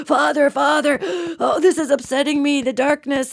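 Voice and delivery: high, whimpering mock breathless plea; fake asthma attack. Demon kid pulls on dad's heartstrings, in a high fake-asthmatic whimper. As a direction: play up being upset and short of air. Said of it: "trying to pull on Dad's heartstrings".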